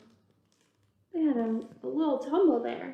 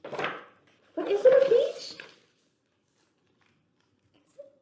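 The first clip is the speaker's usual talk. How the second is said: much higher, slow, louder teasing question; dramatic mystery; 13 semitones higher, 5 dB louder, slower than usual. Acted. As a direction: draw them into the twist.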